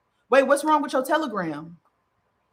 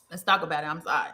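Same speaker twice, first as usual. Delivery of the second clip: choked up; 5 semitones lower, 4 dB quieter, faster than usual. Real, not acted. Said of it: "I'm a little choked up".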